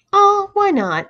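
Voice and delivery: high, loud mock-sympathetic aww; sarcastic. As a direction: fake pity, dripping sarcasm. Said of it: "asked Claire sarcastically".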